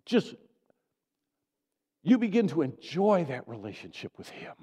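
loud, rising preacher's build; fervent preaching. Real, not acted. Pastor pressing the church to believe, fervent.

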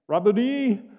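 loud, high, drawn-out cry of recognition. Acted. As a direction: cry it out to a beloved teacher. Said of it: "And she cries out in".

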